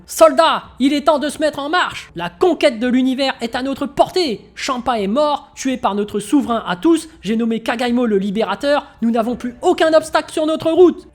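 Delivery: loud, high rousing address to the troops; rallying speech. Acted. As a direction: whip up the soldiers. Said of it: "Et Nagai qui faisait un discours".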